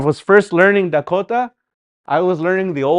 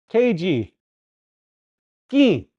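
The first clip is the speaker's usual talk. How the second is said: ordinary delivery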